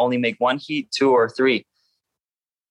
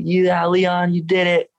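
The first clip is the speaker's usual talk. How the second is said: higher-pitched, imitating thrilled teammates; raised-pitch mock celebration; retold excited cheer; 6 semitones higher, slower than usual. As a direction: mimic a team freaking out, without shouting. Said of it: "freaking out".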